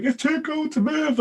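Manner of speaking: put-on high, slow whining kid voice; whiny child. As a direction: drag it out like a freezing sulky child. Quoting a cold child.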